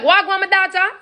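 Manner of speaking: high, friendly Jamaican patois mom; Jamaican mom greeting. Retold or imitated. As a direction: greet your daughter sweetly in Jamaican patois. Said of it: "She pick up mad nice".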